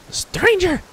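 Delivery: high-pitched agitated creature voice; frantic little mite, very high. Acted. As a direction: squeal it like a tiny creature in distress. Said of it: "The little mite jumps in place".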